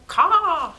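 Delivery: loud high caw; crow caw. Acted. The crow went.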